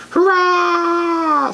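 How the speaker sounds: very high, loud screamed cheer; screaming demon queen. A narrator's reading. Demon queen screaming a cheer at the birthday. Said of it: "screamed the queen of the demons".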